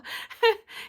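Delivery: tearful shaky laugh-sigh. Real, not acted. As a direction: breathe out a wobbly high laugh. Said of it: "you guys know how emotional I am".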